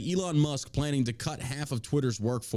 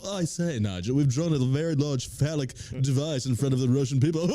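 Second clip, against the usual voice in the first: mock posh British; 4 dB louder than usual. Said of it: "that's like pushing the envelope".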